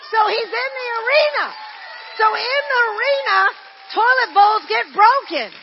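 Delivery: loud, high, fervent preacher shout; full-force sermon shout; preaching at full force. Real, not acted. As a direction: drive the point home to the congregation.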